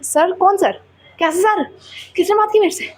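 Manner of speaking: high, puzzled questions; baffled ma'am. Retold or imitated. Ma'am denying any talk, high baffled voice. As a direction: act confused, who's this sir. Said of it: "ma'am भी like".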